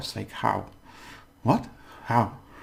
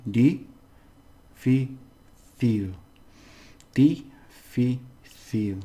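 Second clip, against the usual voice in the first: "Difícil" slowly split into di, fi, cil; syllable by syllable; slower than usual. Shown as a demonstration. Teacher shows the best way to learn it, syllable by syllable. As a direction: separate each syllable of "Difícil" with clear gaps. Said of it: "the best way of this is to take".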